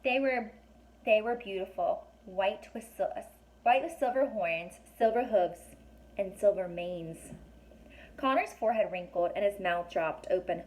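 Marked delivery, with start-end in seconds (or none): none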